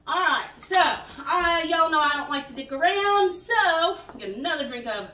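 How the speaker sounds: loud, fired-up coach energy; rally the viewers to keep going; pumped-up call. Real, not acted. Energetic workout host pep.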